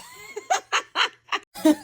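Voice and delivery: high, loud burst of laughter; real laughter. Real, not acted. Host cracking up at the glasses remark, high laughter.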